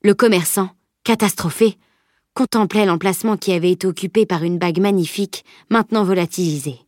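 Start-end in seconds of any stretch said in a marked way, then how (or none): none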